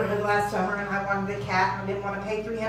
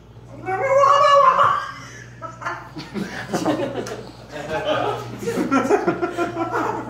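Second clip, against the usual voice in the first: frothing cat noise; 5 semitones higher than usual. Retold or imitated. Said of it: "frothing at the mouth going".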